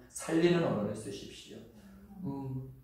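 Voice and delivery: ordinary talk, nothing marked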